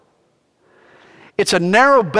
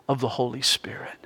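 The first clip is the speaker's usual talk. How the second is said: whispered; 5 semitones lower, 9 dB quieter than usual. Real, not acted. Reverent aside in sermon.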